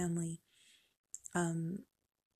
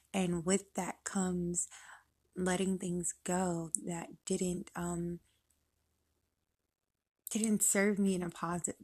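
ordinary delivery

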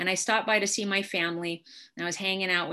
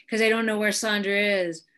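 usual speaking voice

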